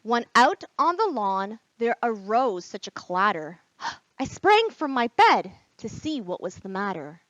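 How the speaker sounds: put-on lively read-aloud for children; teacher reading The Night Before Christmas to kids, animated; storybook reading. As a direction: read it with wide-eyed wonder, like bedtime.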